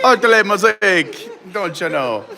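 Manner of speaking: hammed-up, drawn-out French-Jamaican mash-up; bad French accent. Vlogger teased about French turning Jamaican, bad French accent. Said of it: "Anytime you try to do a French accent, it becomes Jamaican".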